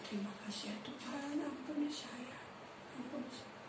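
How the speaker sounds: very soft, retold prayer; hushed prayer. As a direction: drop right down as if praying privately. Retold private prayer to God.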